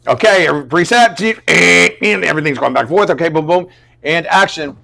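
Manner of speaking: high, loud, bustling set calls; crew callout. Retold or imitated. Crew restarting the take, calling out. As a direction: imitate a crew shouting cues.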